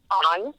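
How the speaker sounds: "on" said like "own", with a long o; "on" rhymes with "own", Arkansas style; southern "on". Shown as a demonstration. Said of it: "He pronounces it like I would say the word O W N".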